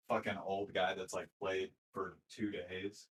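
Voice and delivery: ordinary delivery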